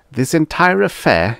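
loud, high-pitched indignation; rumbled. A narrator's reading. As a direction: rumble with wounded dignity. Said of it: "he rumbled".

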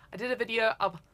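normal talking voice

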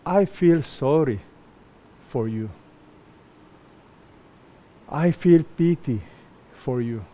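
soft, drooping mock sympathy; mock pity. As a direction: play a lightly hammed-up sympathizer. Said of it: "was somebody very emotional".

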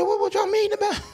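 very high squeaky question; puzzled question. Retold or imitated. Usher asking doctors why they stand outside. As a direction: squeak it out in disbelief. Asks staff outside room.

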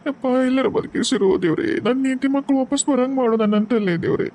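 husband's high weeping plea to God; grieving husband. Acted. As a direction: pray brokenly for wife and children. Husband prays for lost wife.

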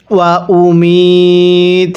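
ওয়া উমিত drawn out slowly, closing on a stopped ত at the pause; pausal recitation. Shown as a demonstration. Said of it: "ওয়াকফ করলে".

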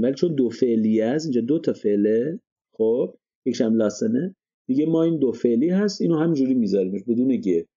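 usual speaking voice

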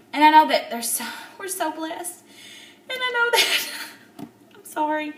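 crying while trying to stay grateful. Real, not acted. Apologising to viewers while crying.

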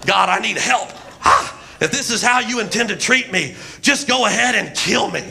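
shouted preaching with punched "Ha!" breaths; hammed-up revival preacher cadence; Pentecostal preacher. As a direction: preach it hard, punctuating with "Ha!". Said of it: "like a Pentecostal preacher".

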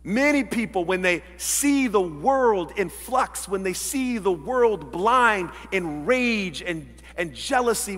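ordinary delivery

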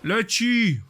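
high, loud drawn-out call. Real, not acted. Gamer announcing something in flight, high loud call. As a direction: sing out a loud warning. Calling out as the thrown thing flies.

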